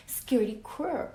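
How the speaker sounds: lively, expressive read-aloud voice; reading to children. A narrator's reading. Teacher reading a story to her class, lively and expressive. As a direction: bring the picture book alive for the class.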